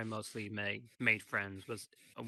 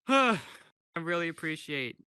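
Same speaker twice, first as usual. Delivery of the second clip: emotional sigh into words; fighting back tears; choked up; 7 semitones higher, 8 dB louder than usual. Real, not acted. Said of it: "trying not to cry".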